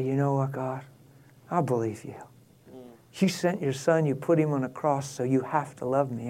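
very soft, private prayer; hushed, low-key talk with God; simple prayer. Shown as a demonstration. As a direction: pray quietly and plainly, straight to God. Models a personal prayer.

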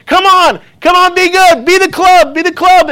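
high-pitched urgent yell at the ball; acted golfer urging the shot on. Golfer pleading with his ball in flight, yelling. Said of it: "he's yelling these things".

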